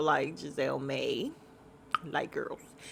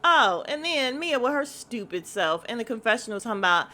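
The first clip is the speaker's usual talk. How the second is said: usual speaking voice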